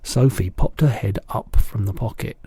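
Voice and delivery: ordinary delivery, nothing marked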